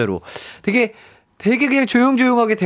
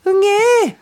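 put-on baby cry; 4 dB louder, much slower than usual. Imitating a newborn's cry.

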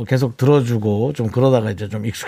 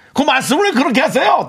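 much higher-pitched mock protest; indignant retort; 11 semitones higher than usual. Imagined wife objecting to an outsider's words, indignant retort. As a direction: bristle in an acted, high-pitched objection. Quotes offended wife's retort.

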